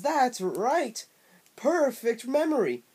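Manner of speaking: high, loud cartoon bobcat voice; obnoxious Bubsy voice. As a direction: ham up an obnoxious cartoon cat. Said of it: "had the most obnoxious voice I've ever heard".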